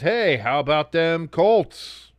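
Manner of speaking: slow, drawn-out mocking read of a chat message; slow taunting chat read. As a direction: stretch it out like a taunt. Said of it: "Chip in the chat says".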